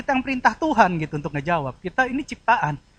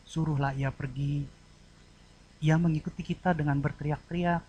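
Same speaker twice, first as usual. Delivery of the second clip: pleading request; 4 semitones lower, 7 dB quieter than usual. Shown as a demonstration. Disciples begging Jesus to act for the woman, soft plea. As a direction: ask gently and humbly, keeping it quiet. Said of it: "Kalimat yang satu memohon".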